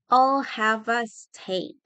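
slow, each word stretched; slow teaching pronunciation; slow model. Shown as a demonstration. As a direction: draw it out for learners. Teacher models phrase slowly.

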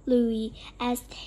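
ordinary delivery

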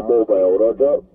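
ordinary talk, nothing marked

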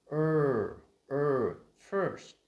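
"er" loud and long with tensed tongue, then high "first"; tense stressed er. Shown as a demonstration. Teacher showing the tightened tongue sound, tense loud demo. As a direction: push "er" and "first" out with force. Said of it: "mình sẽ phải căng".

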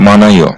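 মানায় said with the হ dropped; Korean pronunciation demo. Shown as a demonstration. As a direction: model মানায় for learners. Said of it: "এই শব্দটার সঠিক উচ্চারণ হবে".

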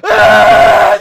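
loud roar of exasperation; frustrated roar. Real, not acted. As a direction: let out a real angry yell. Anguished reaction to the match.

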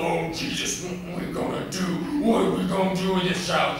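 high, worked-up fretting to himself; agitated self-talk. Acted. Angry Daddy mutters to himself about his child, agitated. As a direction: fret aloud in growing exasperation. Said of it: "he started muttering to himself".

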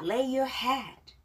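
slow English phrases for repeating; careful, unhurried teaching read; pronunciation model. Shown as a demonstration. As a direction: draw each word out for learners to follow. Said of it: "발음 다시 한번 절 보고 따라하겠습니다".

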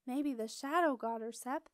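ordinary delivery

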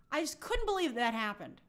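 ordinary talk

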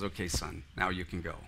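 low, soft night-time murmur; hushed father. Acted. Father wakes his son at night, hushed. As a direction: drop down low and quiet to wake him.

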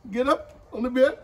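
coaxing command to the dog; high coaxing call. Real, not acted. Coaxing dog onto its bed.

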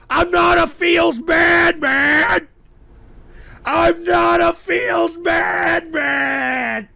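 long, blubbering denial; crying protest. Real, not acted. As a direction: drag the words out in a tearful whine. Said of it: "Stop crying".

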